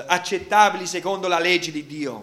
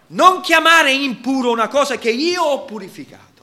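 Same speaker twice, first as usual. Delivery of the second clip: God's loud rebuke; 8 semitones higher, 9 dB louder than usual. Acted. God rebukes Peter in quote.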